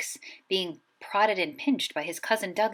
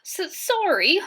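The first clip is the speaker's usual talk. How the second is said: higher, louder stammered sob; sobbed; 10 semitones higher, 5 dB louder, slower than usual. A narrator's reading. Hagrid apologizes to McGonagall, sobbing and stammering. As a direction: choke the apology out through tears. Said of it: "sobbed Hagrid".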